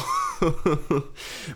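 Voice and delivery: real high laugh; laughing at your own awful impression; helpless high chuckle at yourself. Real, not acted. Laughs at own terrible accent.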